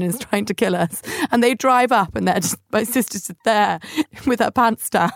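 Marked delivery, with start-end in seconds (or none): none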